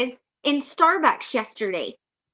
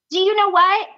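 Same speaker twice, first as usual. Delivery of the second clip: usual speaking voice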